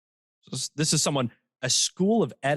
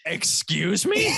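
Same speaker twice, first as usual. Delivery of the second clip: mock offended; 7 semitones higher, much slower than usual. Acting an offended listener.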